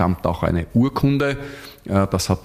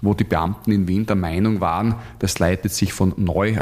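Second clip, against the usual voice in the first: usual speaking voice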